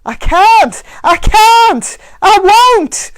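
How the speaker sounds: high, loud desperate screams; screamed. Acted. Mac screaming that he won't choose. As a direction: scream each refusal at the top of the lungs. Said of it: "he screamed".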